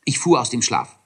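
ordinary delivery

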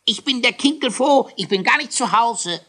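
very high, loud squawk through a door; a frightened dwarf pretending not to be home; squeaky hiding dwarf. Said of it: "quäkte es von drinnen".

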